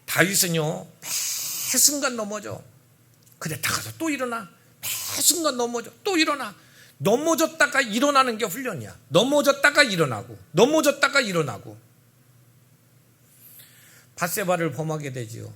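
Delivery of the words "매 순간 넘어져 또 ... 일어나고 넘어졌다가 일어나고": higher, louder with each line; building pulpit fervor; rising preaching. Real, not acted. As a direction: drive the repetition home. Climactic repeated sermon lines.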